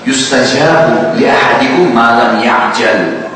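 measured, unhurried Arabic chant; slow Arabic recitation. Acted. Preacher quoting the Prophet to the congregation, slow recitation. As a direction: recite the hadith slowly, word by word.

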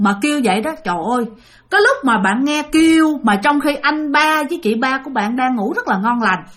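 ordinary delivery

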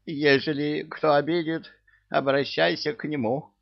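high, whiny little devil; timid devil voice. Acted. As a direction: pitch it up like a teary imp. Coward devil's voice.

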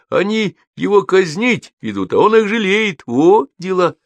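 high, lively gossiping voices; rustling crowd. A narrator's reading. Umbrellas and raincoats gossip about the condemned, high-pitched chatter. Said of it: "шуршат зонты и плащи".

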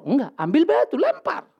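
put-on a boy proudly telling his father how he fought; excited boy. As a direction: blurt out the stone-throwing plan. Boy retells fight to father.

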